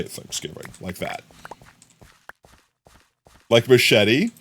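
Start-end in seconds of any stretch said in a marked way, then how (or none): 0.0-1.2 low breathy trailer title; hushed Thanksgiving title; trailer whisper. Shown as a demonstration. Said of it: "you have to say".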